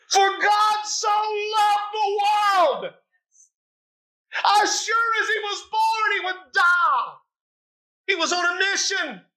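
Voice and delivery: impassioned sermon shout; full-force preaching. Acted.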